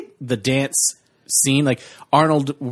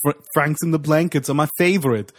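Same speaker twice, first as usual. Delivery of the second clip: light mock Arnold, a bit higher; 5 semitones higher than usual. Podcaster quotes Arnold's favorite snack, lightly voiced Arnold. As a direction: say it like Arnold confessing a snack love. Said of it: "He goes".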